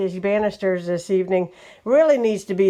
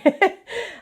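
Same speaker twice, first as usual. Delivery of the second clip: breathy laugh; 11 semitones higher than usual. Real, not acted. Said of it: "I'm out of breath, guys, sorry".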